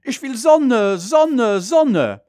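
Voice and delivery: loud, high, wailing father's demand; father's recorded voice. A narrator's reading. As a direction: replay the father's insistent outburst. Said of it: "mit Vaters Stimme". (Vaters Stimme, hoch und laut fordernd)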